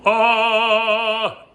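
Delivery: high, loud, open "Ah!" with the nose open; open "Ah!" vowel shout. Shown as a demonstration. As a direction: belt out a bright, obnoxious "Ah!". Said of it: "Ah is not".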